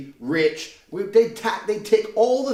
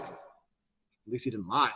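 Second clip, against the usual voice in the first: grudging respect; 7 semitones lower, 5 dB quieter, much slower than usual. Retold or imitated. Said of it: "The part of them are like".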